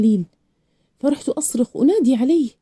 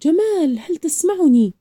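higher-pitched call down the stairs, mildly projected; 6 semitones higher, slower than usual. A narrator's reading. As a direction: call for someone below, pitch raised. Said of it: "صحت من أعلى الدرج".

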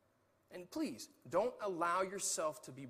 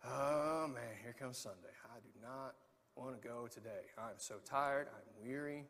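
a worn-out, much softer sigh of a voice at Sunday; weary groan; 6 semitones lower, 6 dB quieter, slower than usual. Pretend. A tired volunteer dreading Sunday, sighing softly. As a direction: drag the words out like you're exhausted. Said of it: "It's like, you just like".

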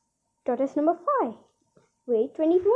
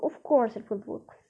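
hushed, secretive whisper; man whispering to his neighbour that the trick will work; 3 semitones lower, 3 dB quieter than usual. A narrator's reading. Confident secret reassurance.

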